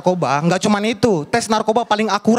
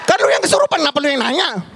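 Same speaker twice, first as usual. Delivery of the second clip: incredulous; 7 semitones higher than usual. Real, not acted. Indignant punchline.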